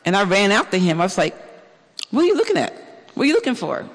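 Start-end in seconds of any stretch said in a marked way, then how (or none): 2.1-3.8 mock curious questions, pitched high; high-pitched, playful retold query; retold curious questioning. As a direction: act out quizzing a passerby, pitch jumping up. Calls after man he chased.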